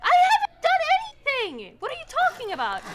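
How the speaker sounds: high, loud, indignant denial; defensive shouting. Real, not acted. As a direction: insist you did nothing, loudly. Heated denial in argument.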